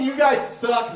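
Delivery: usual speaking voice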